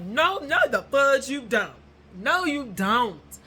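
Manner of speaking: loud, high, slow "no, you don't"; hammed-up refusal to believe it; mock disbelief. Denying the fan follows her.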